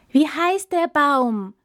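high, slow, loud crowd call; eager animals shouting the question; hopeful cry. Acted. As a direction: call out full of hope. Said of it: "schrien sie voller Hoffnung und Erwartung".

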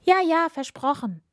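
high, hurried princess voice; hasty agreement. A narrator's reading. Princess brushing off the frog's demands, hasty agreement. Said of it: "sagte die Prinzessin schnell".

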